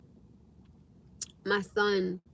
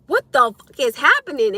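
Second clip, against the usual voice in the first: louder and higher, a mild retold panic; panicked outburst; 5 semitones higher, 9 dB louder than usual. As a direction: let the panic burst out louder. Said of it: "I'm telling myself".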